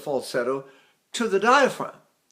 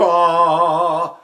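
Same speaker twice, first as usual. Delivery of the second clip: louder ah with the breath attached; attached chest ah; 7 dB louder than usual. Shown as a demonstration. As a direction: anchor the ah on the diaphragm. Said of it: "if I sing with the breath attached".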